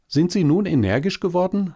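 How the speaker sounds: hoch, schnell und erwartungsvoll; erwartungsvolles Äffchen. A narrator's reading. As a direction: frag gespannt und hoffnungsvoll. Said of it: "fragte das Äffchen und sah das Faultier erwartungsvoll". (Äffchen, hoch, schnell, erwartungsvoll)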